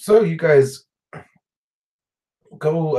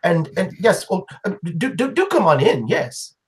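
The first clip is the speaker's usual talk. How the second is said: lady's voice, pitched well up; fussy, welcoming old lady; high old woman voice; 6 semitones higher, faster than usual. Acted. GM voices Jamar again.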